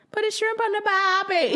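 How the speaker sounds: high, loud mock Australian accent; hammed-up Aussie cliché voice. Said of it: "how they try and do an Australian accent".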